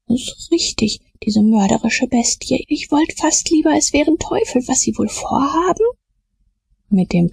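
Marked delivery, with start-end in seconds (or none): 0.1-5.9 high, anxious confirmation; scared dread, pitch climbing; fearful boy. Acted. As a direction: worry aloud in a raised boyish pitch. Frightened boy confirms the murderer.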